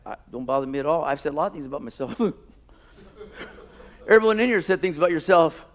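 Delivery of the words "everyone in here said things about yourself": laughing. Real, not acted. Joking with congregation, laughing.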